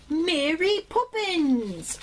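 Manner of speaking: slow, high mock-British accent; bad British accent. Announcing a Mary Poppins record to viewers, bad British accent. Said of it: "I can't do an accent for peanuts".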